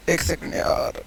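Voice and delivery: very quiet, barely voiced aside; low, hushed thinking aloud; muttering to self. Real, not acted. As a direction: mumble to yourself while checking the working. Pauses to check his working.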